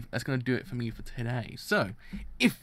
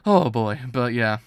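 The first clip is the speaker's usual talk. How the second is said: loud exclamation; 10 dB louder, slower than usual. Real, not acted. Overwhelmed end of thoughts.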